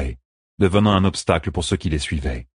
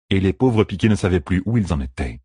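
ordinary delivery

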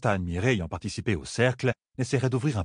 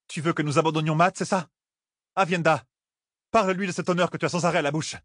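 high, fast, challenging; defiant Nineveh; 8 semitones higher, faster than usual. Acted. Nineveh defying Elaine, high and fast. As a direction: throw the question back at her.

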